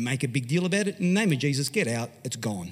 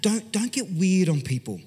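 slow emphasis; 3 semitones higher than usual. Real, not acted. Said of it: "slows to stress point".

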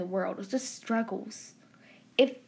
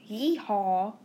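a touch of put-on Southern twang; mock Southern accent. Podcaster mocking Northerners' idea of Southern speech, mock Southern accent. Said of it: "us Southern people have an accent".

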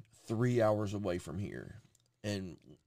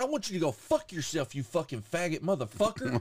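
higher-pitched mock rant; retold angry cursing; 7 semitones higher than usual. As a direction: reenact cussing out the drive-thru. Retold cursing back at worker.

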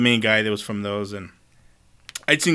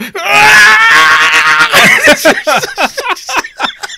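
long, very high, loud scream; imitated screaming meltdown; 11 dB louder than usual. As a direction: shriek like a gamer losing it, then laugh. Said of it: "And you hear him".

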